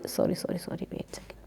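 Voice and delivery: hushed aside, away from the mic; quick whispered apology off to the side; whispered sorry. Real, not acted.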